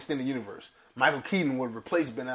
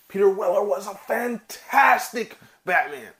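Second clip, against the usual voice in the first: excited; 7 semitones higher, 6 dB louder, slower than usual. Real, not acted. Excited praise of actor.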